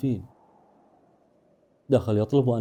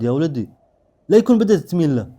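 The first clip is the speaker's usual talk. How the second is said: louder, higher, sharp warning; sharp-voiced grandfather; 5 semitones higher, 7 dB louder than usual. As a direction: confront him sharply, pushing louder as you go. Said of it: "قال لي بصوت حاد". (بصوت حاد)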